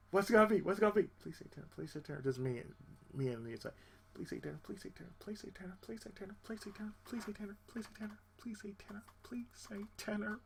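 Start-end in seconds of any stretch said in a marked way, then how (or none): 1.2-10.4 much softer, murmured repeated plea; hope silently, over and over; pleading under breath. Retold or imitated. Choir tenor praying the newcomer is a tenor, softly pleading.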